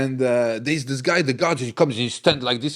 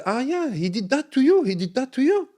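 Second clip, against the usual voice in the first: much higher, put-on incredulous voice; cellmate's disbelief; 12 semitones higher than usual. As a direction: raise it up high like a friend who can't believe it. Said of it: "This guy say".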